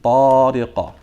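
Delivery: loud, sustained Tariqa ending in a short echoing "qa" bounce; qalqala pop. Shown as a demonstration. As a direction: chant Tariqa on a level note, long "taa", popped qaf at the end. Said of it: "So let's try it again".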